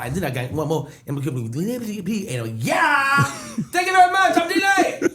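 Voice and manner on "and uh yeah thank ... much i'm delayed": high, loud show-off closer; triumphant shout. Retold or imitated. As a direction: belt it like a star. Imagined triumphant closing line.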